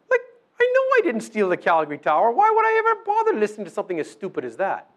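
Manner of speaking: a listener waving off a silly accusation, mock indignant; mock indignant dismissal. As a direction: scoff it off like the idea is absurd. Voices own indignant dismissal.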